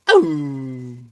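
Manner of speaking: loud, high imitated howl; long falling "ow-ooo"; dog howl. Shown as a demonstration. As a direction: howl to get the dogs going. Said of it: "do some howls with the boys".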